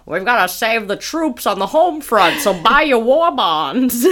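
broadcast like a wartime newsreel; old newsreel narrator. Pretend. Old-timey man at a bar.

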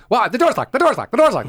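high, repeated mock-panicked alarm; freaking out. Waitress locked out, high frantic retold alarm. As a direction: imitate a waitress flapping over a door. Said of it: "the waitress who started freaking out".

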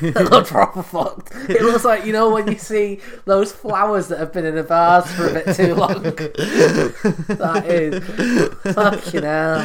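talking through laughter, loud; cracking up while describing; laughing. Real, not acted. Friend reacts to dog photo.

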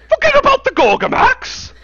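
very high, loud outburst; outraged cartoon cry. Quoting a South Park episode, outraged shriek. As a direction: screech it back in disbelief. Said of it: "That's from a South Park episode".

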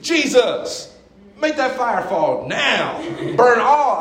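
high, slow mock demand; hammed-up call for fire; mock fury. Disciples begging Jesus for fire, high mock fury. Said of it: "They were like".

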